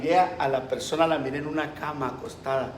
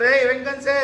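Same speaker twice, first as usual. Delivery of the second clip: calling out; 9 semitones higher, 7 dB louder, slower than usual. Acted. Demonio llama a otros espíritus.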